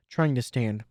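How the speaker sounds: ordinary delivery, nothing marked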